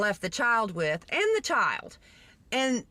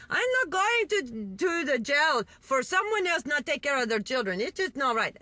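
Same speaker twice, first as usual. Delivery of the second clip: bad Jamaican accent; 4 semitones higher than usual. Friend protests going to jail, poorly imitated Jamaican accent. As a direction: imitate an indignant Jamaican woman, badly. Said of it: "I can't do a Jamaican accent".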